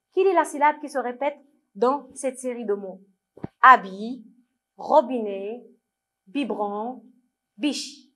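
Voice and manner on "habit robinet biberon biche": ordinary delivery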